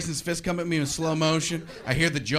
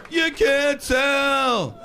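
high, drawn-out sitcom villain cry; Newman; 8 semitones higher, 6 dB louder, much slower than usual. Acted. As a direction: stretch it high in exaggerated disbelief. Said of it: "the guy who plays Newman".

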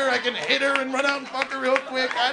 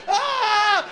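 sudden high yelp of fright, laughing; startled scream; 10 semitones higher, 6 dB louder than usual. Retold or imitated. Comedian shrieking at a man peering under the booth door.

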